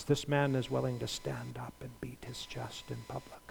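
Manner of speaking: drops to a whisper for effect; hushed. Real, not acted. Preacher whispering to the congregation. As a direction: lean in and whisper it.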